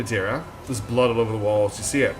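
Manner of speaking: ordinary delivery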